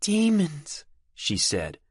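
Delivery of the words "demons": higher, uncertain testing tone; trying the word. Acted. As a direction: taste the unfamiliar word. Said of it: "trying the word on".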